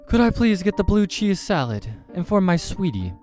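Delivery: high, loud, gushing order; over-sweet man on a date; eager customer. Acted. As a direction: order keenly, pitched well up.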